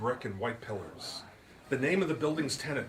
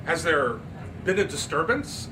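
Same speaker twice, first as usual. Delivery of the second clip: haughty society lady; 5 semitones higher, 6 dB louder than usual. Acted. Miss Turpin voiced.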